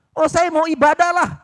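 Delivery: high, loud imitation of a churchgoer; mock the easy promise, loudly; hammed-up eager promise.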